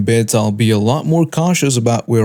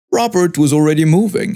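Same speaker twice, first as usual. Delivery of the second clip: usual speaking voice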